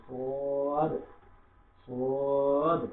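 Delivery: সোয়াদ with a thick, heavy s, drawn out; heavy sad. Shown as a demonstration. Teacher models সোয়াদ for repeating, thick and long. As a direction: make সোয়াদ full and heavy, held long. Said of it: "এটা মোটা করে উচ্চারণ করবো".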